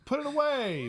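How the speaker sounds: very high, appalled mock plea; retold high-pitched exasperation; mock scolding. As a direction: squeal it at the dog in mock disgust. Acted scolding of the dog.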